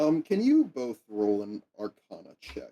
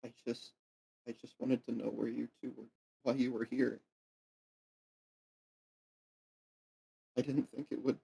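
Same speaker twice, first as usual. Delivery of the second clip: quiet; 8 dB quieter than usual. Acted. GM voices shy kid NPC.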